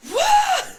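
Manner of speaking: sudden high loud shriek; startled scream. Real, not acted. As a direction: yelp in real fright. Startled by crab-walking son at night.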